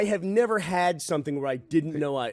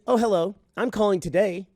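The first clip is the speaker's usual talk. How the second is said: higher-pitched scripted call-center greeting; imitated robotic telemarketer; 5 semitones higher than usual. Telemarketer opening a cold call, imitated robotic sales voice. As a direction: mimic an automated caller's chirpy opening. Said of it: "This is exactly how she sounded".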